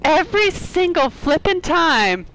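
high, slow, drawn-out complaint; high-pitched fed-up wail; exasperated cry. Real, not acted. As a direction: stretch every word in frustration. Frustrated at repeated game ambush.

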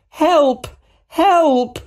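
high, loud scream for help. A narrator's reading. MJ calls to Spider-Man in danger, screaming. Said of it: "M J screamed".

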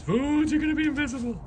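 pet talk. Real, not acted. Teasing dog in snow.

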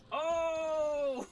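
surprised shout. Real, not acted. Clean play in game.

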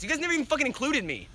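high, indignant rant building to a yell; aggrieved outburst. Acted. Accusing friends of leaving him out, building to a yell. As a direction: climb in pitch, then burst out loud.

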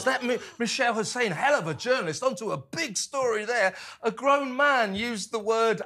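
high mocking sing-song praise; sarcastic.

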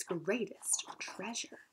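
slow, hushed whisper; secretive whisper. A narrator's reading. Madison tempting the giant, whispering of treasure.

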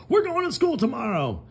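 the farmer bursting with news; excited Farmer Brown. Acted. Farmer Brown tells the cows about school, excited. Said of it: "he told the cows".

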